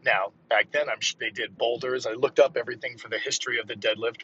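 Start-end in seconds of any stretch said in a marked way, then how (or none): none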